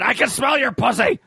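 high yelled catcall; shouting it out high; screaming. Retold or imitated. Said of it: "So Andrew screamed out".

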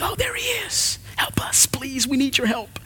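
breathy hushed plea, high then whispered; hushed pleading. Acted. Mourners pleading with Peter, whispered and desperate. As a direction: beg him softly through tears.

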